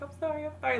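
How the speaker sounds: high, soft, hurried-sounding sorry; mock apologetic little voice; acted apology. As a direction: imitate a sheepish apology in a small high voice. Acting out apology after punching.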